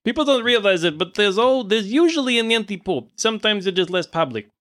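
put-on high-pitched foreign-accented villain; villain lecturing with a put-on accent; accented anti-pope. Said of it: "Sorry, is my accent".

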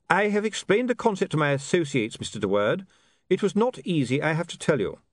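high, measured character voice; earnest Duckman. A narrator's reading. Duckman reports to William on his associates, earnest.

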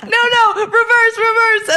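high-pitched frantic backtracking; mock panic. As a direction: imitate a kid desperate to undo the damage. Children backtracking from mom's meltdown.

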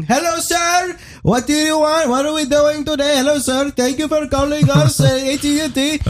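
screaming Indian salesman. Retold or imitated. Mocking the salesman who screamed on the phone, in an Indian accent. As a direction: shout the greeting like a pushy salesman, in an Indian accent. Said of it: "he's fucking screaming on the phone at me".

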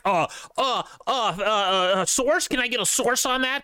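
high, sputtering know-it-all voice; mock a pedantic online skeptic; mocking nerd voice. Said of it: "They're like".

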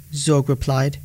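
ordinary delivery, nothing marked

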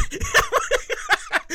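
high burst of real laughter; helpless giggle; laughing. Real, not acted. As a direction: crack up at your own joke. Cracks up at own joke.

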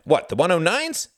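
high, loud incredulous question; indignant demand. A narrator's reading. Pilot challenging a man's doubt about his fighter, indignant. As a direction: demand it sharply, rising at the end. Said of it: "demanded Jorgen".

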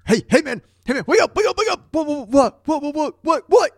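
high, frantic whispered alarm; panicked wake-up. Retold or imitated. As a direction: shake a sleeping friend in a panic. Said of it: "what that must have sounded like".

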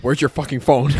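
loud, accusing demand; mock accusing shout at the girlfriend about her hidden phone; mock yell. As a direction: bark it like you caught her out.

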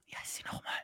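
whispered aside. Real, not acted. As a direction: whisper it off mic. Whispered aside forgetting name.

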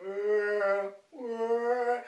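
a lightly voiced cow bleat; cow moo. The cows wake up in the flashlight beam, mild mock mooing. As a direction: play the herd waking up, a hint of lowing. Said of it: "they all go".

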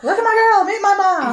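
high, bouncy proud squeal; giddy prince. Retold or imitated. Prince presenting his girl to his mom, giddy high squeal. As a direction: show her off like an overjoyed boy.

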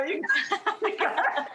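words spill out through a high laugh. Real, not acted. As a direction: laugh your way into the line. Laughing at the Cana comparison.

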